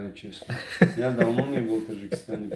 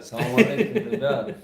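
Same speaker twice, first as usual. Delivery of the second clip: laughing greeting; 8 semitones higher than usual. Real, not acted. Greets viewers while laughing.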